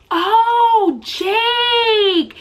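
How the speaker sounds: high, loud drawn-out oh Jake; loud realization. Retold or imitated. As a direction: call out the name in sudden relief. Finally reads the written name.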